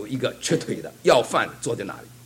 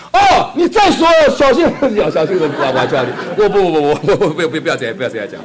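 laughing protest; 2 semitones higher, 7 dB louder than usual. Retold or imitated. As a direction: blurt it out, laughing. Young brother jumps up, interrupts.

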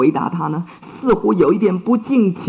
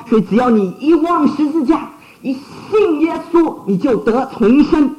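raised, urgent sermon voice; fervent preaching; 7 semitones higher than usual. Acted. Preacher urging his flock to be reborn, fervent and raised. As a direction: plead with the hearer to be reborn.

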